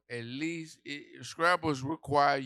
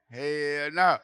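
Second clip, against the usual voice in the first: higher, louder second hell no; 4 semitones higher, 9 dB louder than usual. Retold or imitated. Comedian topping his own refusal, louder. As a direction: punch it up high and loud. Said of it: "I said".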